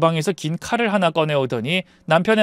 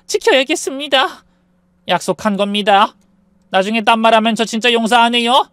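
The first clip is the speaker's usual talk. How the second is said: higher-pitched, insistent character voice; firm warning; 5 semitones higher, 5 dB louder than usual. A narrator's reading. Someone holding another to a promise, firm warning.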